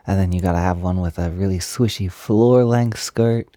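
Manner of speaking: ordinary delivery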